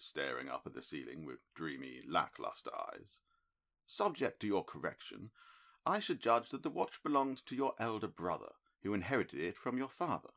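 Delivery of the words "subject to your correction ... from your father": a touch higher, crisp detective; measured, mildly disappointed sleuth; a hint of a cool analytical detective; Holmes, lightly voiced. A narrator's reading. Holmes deducing.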